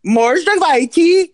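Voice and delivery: Можно войти very high, loud and slow; hammed-up attempt. Shown as a demonstration. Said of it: "Yeah, that's right".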